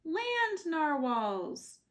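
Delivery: awed reveal. A narrator's reading. Narrator revealing the land narwhals, slow and awed. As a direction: stretch it out in wonder.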